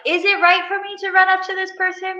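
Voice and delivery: high, anxious inner voice; high-pitched starstruck dithering, acted out; flustered inner debate. Acting out her starstruck hesitation.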